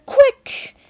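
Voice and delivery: urgent. A narrator's reading. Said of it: "ordered Mister Wonka".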